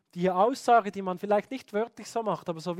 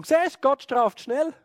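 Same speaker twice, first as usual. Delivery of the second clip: slow, pointed, higher and louder; told you so; 7 semitones higher, 7 dB louder, slower than usual. Retold or imitated. A parent tells the child God punishes fast, smug and slow.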